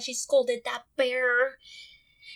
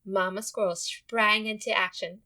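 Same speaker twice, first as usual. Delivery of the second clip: usual speaking voice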